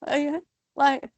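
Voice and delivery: high, teasing hint to leave; hint she should move on, playfully high; teasing nudge. Retold or imitated. Volunteers nudging chatty Mel to leave, playfully high. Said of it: "they'd be like".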